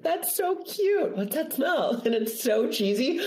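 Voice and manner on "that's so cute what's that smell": put-on high, slow sing-song to a child; sweet voice for kids. A mother distracting her kids from stress, high and sweet. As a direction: coax a small child sweetly. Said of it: "I do that with the kids".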